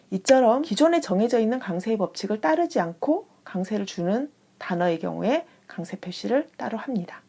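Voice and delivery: ordinary talk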